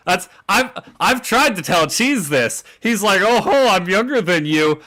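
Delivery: ordinary talk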